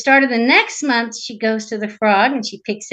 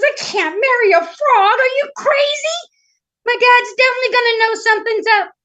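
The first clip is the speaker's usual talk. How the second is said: high, loud, incredulous princess; appalled princess; 13 semitones higher, 6 dB louder than usual. Acted. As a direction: protest in a high-pitched panic. Princess appalled at frog's proposal.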